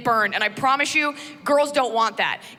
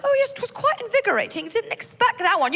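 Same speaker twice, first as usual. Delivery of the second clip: much higher dainty English voice; prim British lady; 11 semitones higher than usual. Retold or imitated. Wife replying at breakfast, high prim British. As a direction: sing it like a delicate lady. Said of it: "the girl is like".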